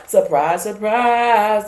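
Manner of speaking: mock surprise. Real, not acted.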